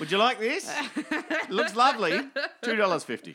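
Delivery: cheery shopkeeper. Retold or imitated. Op shop woman offering an item for sale, high loud cheery voice. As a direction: sell the booties brightly through a laugh. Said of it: "imagine her with her hands clapped over her ears".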